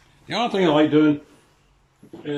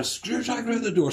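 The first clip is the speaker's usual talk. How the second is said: high, disbelieving repeat of the question; can't believe what you just heard; mock astonishment, pitched up; incredulous echo; 9 semitones higher, 3 dB louder than usual. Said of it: "I said".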